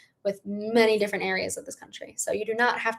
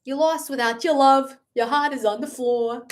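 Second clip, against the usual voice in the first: [name]'s Boston accent; 3 semitones higher than usual. Shown as a demonstration. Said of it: "I think it's a Boston".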